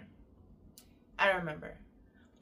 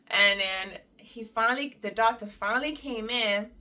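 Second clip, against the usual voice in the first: ordinary delivery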